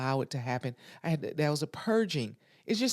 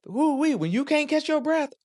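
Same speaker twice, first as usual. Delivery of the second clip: pitched far up and louder; woo-wee, high and loud; excited whoop; 13 semitones higher, 7 dB louder than usual. Real, not acted. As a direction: whoop it like it's too much. Recalling a breathless childhood cry.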